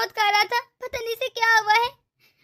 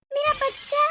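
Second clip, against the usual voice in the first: usual speaking voice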